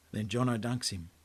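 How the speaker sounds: ordinary delivery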